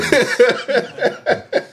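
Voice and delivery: a burst of high, real laughter. Real, not acted. Laughing at uncle's long sentence.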